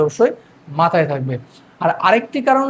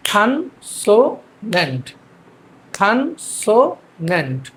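"খানসোনেন্ট" very slow in three spaced beats; syllable by syllable; much slower than usual. Shown as a demonstration. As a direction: drill "খানসোনেন্ট" as khan, so, nent. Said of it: "আমরা এটাকে কীভাবে বলবো". ("খানসোনেন্ট" তিন ভাগে ভেঙে ধীরে)

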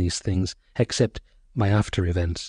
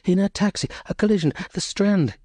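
high, breathless fragments; frightened nurse, gasping out details; terrified blurting, pitched high; 10 semitones higher than usual. Acted. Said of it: "she gasped".

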